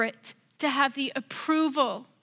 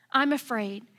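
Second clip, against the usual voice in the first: ordinary talk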